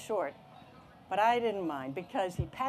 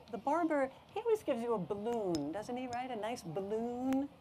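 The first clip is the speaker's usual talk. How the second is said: higher, singsong persuasion; coaxing; 5 semitones higher than usual. Acted. Mom talking up the barber's balloon, coaxing. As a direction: lilt up hopefully, stretching the last words.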